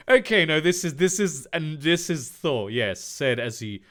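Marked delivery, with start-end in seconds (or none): none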